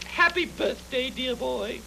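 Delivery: ordinary delivery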